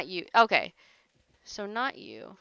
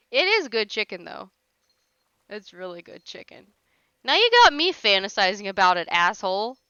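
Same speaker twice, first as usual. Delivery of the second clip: ordinary talk, nothing marked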